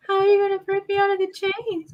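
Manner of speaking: high pleading captive voice; high captive plea. Pretend. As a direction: plead from the chains. Acting captive line.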